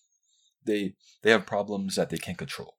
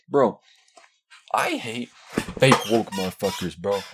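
loud rant; 7 dB louder than usual. Real, not acted. Ranting about fake woke people.

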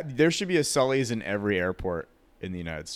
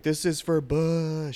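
higher, slow mock pilot voice; hammed-up drawn-out pilot line; playing the pilot in a slow put-on voice; mock Sully; 6 semitones higher, slower than usual. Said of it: "He's like".

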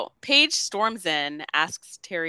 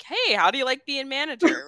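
higher, breezy retold greeting; mock-friendly coach; 7 semitones higher, 4 dB louder than usual. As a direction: toss out a chummy question as the coach. Voicing coach's greeting to Liberty.

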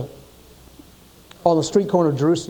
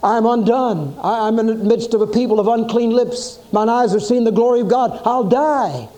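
higher, stammering cry of awe; 6 semitones higher than usual. A narrator's reading. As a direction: cry out, overwhelmed before God's glory. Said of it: "And Isaiah cried out".

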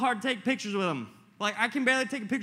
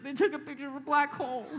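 higher, fast, laughing through the words; laughing wonder; 8 semitones higher, faster than usual. Retold or imitated. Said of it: "I was like".

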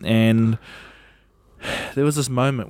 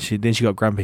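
usual speaking voice

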